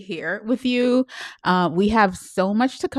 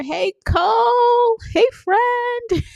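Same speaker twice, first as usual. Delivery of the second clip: drawn-out, much higher delighted hello; squealed greeting; much slower than usual. Real, not acted. Greets a viewer in comments.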